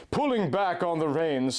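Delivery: ordinary talk, nothing marked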